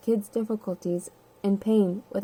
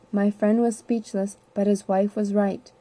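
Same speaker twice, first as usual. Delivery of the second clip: ordinary delivery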